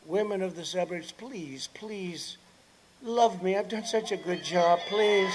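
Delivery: soft, hushed mock begging; joking plea. Candidate repeats his joke to suburban women, soft mock pleading.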